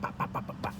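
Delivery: soft whispered pops, unvoiced; button-pressing noises. Cashier punching in an order, whispered pop sounds. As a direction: tap out the register keys under your breath.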